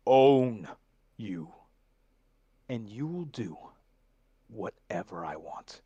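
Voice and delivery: I own you punched, then whatever I want dropped to a whisper; menacing contract villain. Shown as a demonstration. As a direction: close like a whispered threat of ownership. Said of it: "There it is".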